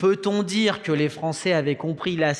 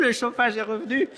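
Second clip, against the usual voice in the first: laughing; 7 semitones higher than usual. Real, not acted. Heating comes back mid-sermon.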